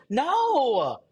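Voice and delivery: high, exclaimed no and oh my goodness; shocked disbelief. Acted. Reacting to a friend's outfit, shocked high exclaim. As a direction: react like you can't believe the outfit.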